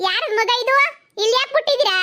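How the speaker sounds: high, loud call across the street; hollering to the neighbours about a found baby. Acted. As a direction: shout for the owners to come. Calling out to neighbours.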